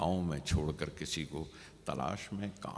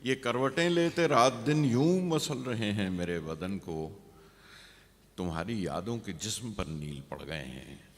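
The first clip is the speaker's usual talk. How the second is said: poem recitation; 3 semitones higher, 5 dB louder than usual. Acted. Poet repeats the verse after praise, raised recitation. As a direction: recite it out to the room, then let it settle.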